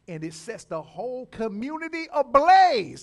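loud, rising peak; excited climax. Real, not acted. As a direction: build to a shout. Climax of miracle story.

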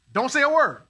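a sudden loud command of silence; commanding shout. Retold or imitated. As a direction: bark it out as God's order.

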